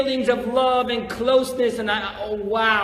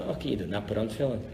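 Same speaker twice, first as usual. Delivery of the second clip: much softer, lower, quick resigned mutter; grudging mutter; 10 semitones lower, 10 dB quieter, much faster than usual. Retold or imitated. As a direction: give in under your breath, sulking. Grudging worshipper giving in.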